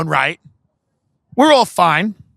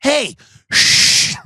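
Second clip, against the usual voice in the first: higher-pitched hey into a long shush; mock shushing; 8 semitones higher than usual. Friend hushing his mom, mock shushing. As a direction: cut mom off with an imitated shush. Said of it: "He'd go".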